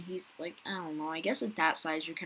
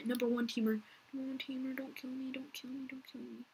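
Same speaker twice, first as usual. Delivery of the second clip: fading plea; 5 semitones higher, 9 dB quieter than usual. Real, not acted. Begging a big player.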